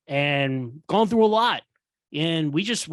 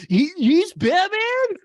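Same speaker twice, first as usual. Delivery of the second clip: much higher and louder, slow; mock disbelief; 13 semitones higher, 6 dB louder, much slower than usual. Host mocking the Flash, incredulous high squeal. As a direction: stretch it out in outraged disbelief. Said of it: "He's like".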